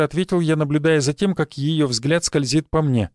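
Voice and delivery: plain reading voice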